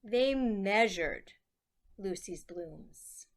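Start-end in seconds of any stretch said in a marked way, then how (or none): none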